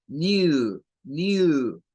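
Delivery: "new" said with a y-glide, like "you". Shown as a demonstration. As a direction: stretch "new" as nyoo. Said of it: "como cuando decimos you".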